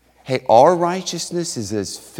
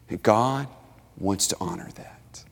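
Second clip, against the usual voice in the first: whispered, much softer close; hushed whisper; 5 semitones lower, 7 dB quieter than usual. Acted. Preacher closing on true righteousness, hushed whisper. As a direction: breathe it out as a hush.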